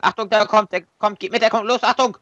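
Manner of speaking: urgent shouted call for cover. Real, not acted. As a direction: yell for cover under fire. Teammate under fire calling cover.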